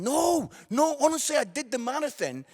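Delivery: high shouted protest; loud protest. Real, not acted. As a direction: yell the denial at the crowd. Protesting to the crowd.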